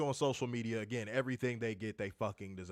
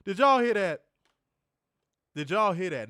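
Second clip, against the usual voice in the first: ordinary talk, nothing marked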